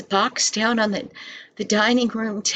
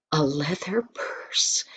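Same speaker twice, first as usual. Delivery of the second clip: softer, lower whispered reveal; hushed reveal; 6 semitones lower, 6 dB quieter than usual. A narrator's reading. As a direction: whisper it like a secret treasure. Surprise gift pulled from box.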